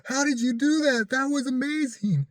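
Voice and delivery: high, breathless amazement; amazed. Retold or imitated. Woman in the back amazed at the driver's calm, high awed gushing. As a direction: gush in awe at the driver. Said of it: "And she's like".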